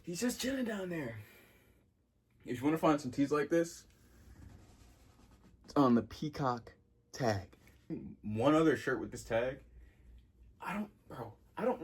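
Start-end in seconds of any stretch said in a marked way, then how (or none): 0.0-1.2 voicing the cow in a much higher, softer squeak; lightly voiced cow on the shirt, higher and soft; high cartoon cow voice. Shown as a demonstration. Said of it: "Look at him". 10.6-11.8 whispered aside. Real, not acted. Aside while filming.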